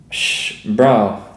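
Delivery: ordinary delivery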